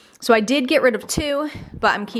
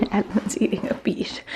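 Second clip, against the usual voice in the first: whisper; 4 dB quieter than usual. Real, not acted. Amused aside about child eating.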